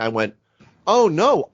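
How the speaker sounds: ordinary talk, nothing marked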